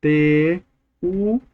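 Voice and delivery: "Conteúdo" split slowly into con, te, ú; very slow, stretched "Conteúdo", holding the ú; syllable-by-syllable demo. Shown as a demonstration. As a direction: sound out "Conteúdo" with long gaps between syllables. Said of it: "Vem comigo".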